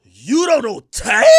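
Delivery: loud, slow, drawn-out question; mock her astonishment, stretching each word; imitating incredulous girl. Imitating the girl's disbelief.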